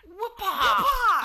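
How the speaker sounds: loud high whip-crack yell. Retold or imitated. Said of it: "the thing from Friends with Chandler when he does the whip sound".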